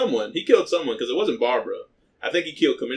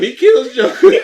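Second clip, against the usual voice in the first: higher and louder, laughing while telling; can barely get it out for laughing; 11 dB louder than usual. Real, not acted. Laughing at absurd plot twist.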